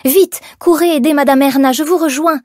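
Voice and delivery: high, loud hurried command; urgent order. A narrator's reading. Young woman sending coworkers to help boss, urgent order. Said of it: "leur ordonna Liselle".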